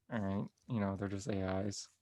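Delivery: low, soft brush-off; resigned mumble. Retold or imitated. Tony answering the man with a phone, quietly resigned. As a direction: imitate a deflated reply under the breath. Tony deflated after being heckled.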